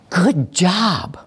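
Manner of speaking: drawn-out, emphatic "Good job"; evaluative praise. Shown as a demonstration. Teacher judging a followed command, emphatic praise. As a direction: hand down praise, landing on "job". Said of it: "see if you can feel the difference".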